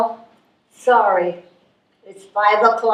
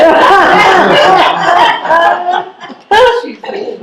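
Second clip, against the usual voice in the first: loud high laugh breaking out; burst of laughter; 10 semitones higher, 12 dB louder than usual. Acted. Caseworker laughing at the phone menu. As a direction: laugh out loud at the absurd message.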